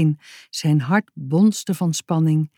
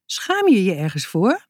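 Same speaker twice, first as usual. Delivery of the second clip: verbaasd; 6 semitones higher than usual. A narrator's reading. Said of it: "vroeg ze verbaasd".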